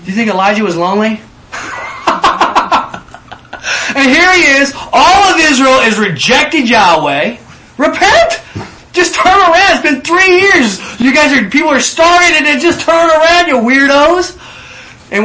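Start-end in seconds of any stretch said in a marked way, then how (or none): 1.5-3.9 a burst of high airless laughter; high breathy laugh. Real, not acted. Laugh before the prophet's call. 3.9-14.4 high, loud, half-laughing plea to repent; loud prophetic call. Acted. Preacher voicing Elijah's call to repent.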